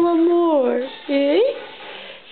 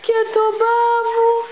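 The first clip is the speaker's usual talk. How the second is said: higher, whiny crying complaint; cranky baby voice; 4 semitones higher than usual. Pretend. Mother speaking as her baby who wants to sleep, higher whiny crying. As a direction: complain like a tired toddler on the edge of tears.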